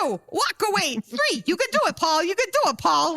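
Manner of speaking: high, hammed-up coaching count; Kevin Dunn. Counsellor's voice urging the ex away, hammed-up. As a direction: urge him on, voice shooting up. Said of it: "all you hear is, like, Kevin Dunn's voice".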